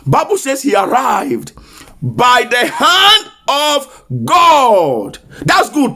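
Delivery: rising, ringing prophetic declaration; full-force preaching. Acted. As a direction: proclaim it over the congregation. Prophetic declaration, month of arrival.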